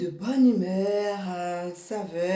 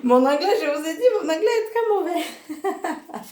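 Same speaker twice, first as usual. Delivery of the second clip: giggling through it, voice jumping up higher; laughing apology; 9 semitones higher than usual. Real, not acted. Apologising to friends for her English, laughing.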